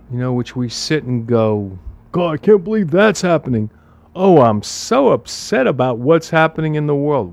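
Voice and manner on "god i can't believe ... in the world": mock whining. Parodying people upset at the news.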